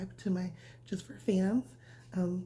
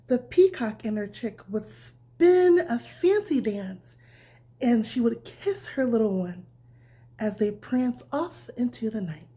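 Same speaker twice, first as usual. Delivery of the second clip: bedtime story reading; 2 semitones higher, 9 dB louder, slower than usual. A narrator's reading. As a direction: read it gently like tucking a child in. Reads bedtime story to children.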